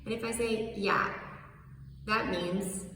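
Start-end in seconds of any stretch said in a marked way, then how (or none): 0.8-1.1 curt, falling "Yeah"; annoyed yeah. Shown as a demonstration. Teacher showing a fed-up yes: annoyed "Yeah". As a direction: drop "Yeah" short and irritated. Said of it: "I'm annoyed, but fine".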